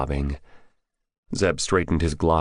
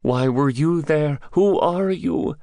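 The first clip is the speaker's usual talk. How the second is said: high-pitched, insistent captive; high character voice, demanding answers; challenging captive; 11 semitones higher, 4 dB louder, slower than usual. Acted. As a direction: throw the questions back, much higher. Captive questions his captor back.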